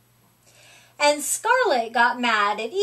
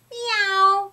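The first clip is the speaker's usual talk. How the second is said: a higher meow, lightly voiced cat; 7 semitones higher than usual. A narrator's reading. The cat agrees with a lightly voiced higher meow. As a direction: mew once in agreement. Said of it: "said Cat".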